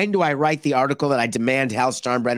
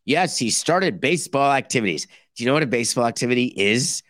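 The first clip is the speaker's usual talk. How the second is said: ordinary delivery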